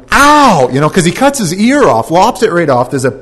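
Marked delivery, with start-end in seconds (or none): 0.1-0.7 much higher, louder yelp; cry of pain. Acted. Servant whose ear was cut cries out, loud yelp. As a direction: yelp like you were just cut.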